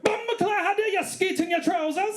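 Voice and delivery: high, outraged Jamaican woman; angry Jamaican accent, hammed up; mock Jamaican accent. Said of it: "She was Jamaican too".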